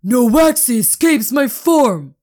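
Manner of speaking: high, loud enraged bellow; furious shout. Acted. Owner chasing through cactus, screaming in fury. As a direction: roar it in triumph and rage. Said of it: "he shouted".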